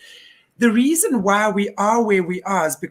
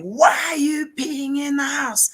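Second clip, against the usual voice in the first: higher-pitched imitation of an angry man shouting; mock yell; 7 semitones higher than usual. Angry neighbour yelling at his dog, mildly imitated shout. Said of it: "I hear this almighty screaming".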